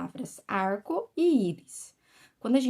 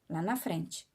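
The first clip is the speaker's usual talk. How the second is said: usual speaking voice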